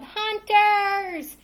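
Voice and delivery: high, loud alarmed shout; yelled. A narrator's reading. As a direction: shriek a warning. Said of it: "and yelled".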